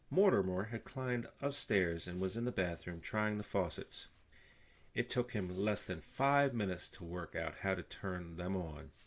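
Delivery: ordinary delivery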